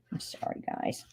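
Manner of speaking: whispered aside, sorry; whispered apology. Real, not acted. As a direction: breathe the apology under your breath. Apologising while struggling.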